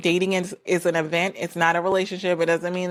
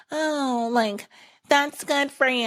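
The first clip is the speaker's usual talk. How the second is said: higher, sugary mock-condescending voice; fake kindness; 6 semitones higher, slower than usual. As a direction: patronise them with a sweet tone. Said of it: "it's not a fake kind".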